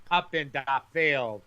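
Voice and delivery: slow imitation of a Polish-accented mother. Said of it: "that was a Polish accent".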